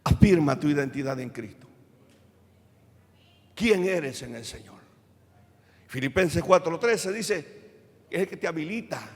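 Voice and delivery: usual speaking voice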